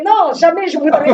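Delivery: put-on Italian mother superior refusing, light Italian accent. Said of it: "elle m'a dit avec l'accent italien".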